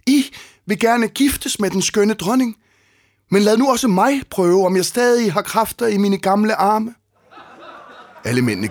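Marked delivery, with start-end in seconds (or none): 0.1-6.9 put-on much higher, thin old beggar voice. A narrator's reading. Disguised Odysseus addresses the suitors, high thin old voice. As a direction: pipe up like a frail old man. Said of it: "sagde han med høj, spinkel stemme".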